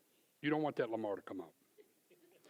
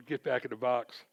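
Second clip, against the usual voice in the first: louder, laughing rebuke; lightly voiced God, amused; God's voice; 9 dB louder, slower than usual. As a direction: tell the old self to get back in the box. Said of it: "God's saying".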